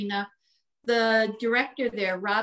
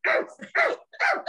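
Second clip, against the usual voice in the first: high straining rrr noises, mock effort; effortful growl; faster than usual. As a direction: grunt as if dragging skates. Pulling a skater's skates again.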